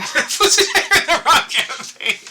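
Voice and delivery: high, slow, laughing through it. Real, not acted. Game master amused by wrong campaign reference, laughing. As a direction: crack up while talking.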